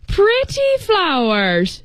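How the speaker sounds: put-on high, slow, doting old lady; cooing old woman. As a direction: coo it like a fond granny. Old woman admiring the flowers.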